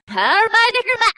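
spell incantation. Acted. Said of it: "She says".

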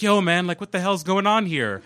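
march up and demand answers; confronting yell. Retold or imitated.

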